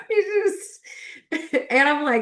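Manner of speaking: words caught in a high laugh. Real, not acted. Overwhelmed by a word, laughing it out. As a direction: giggle through the words.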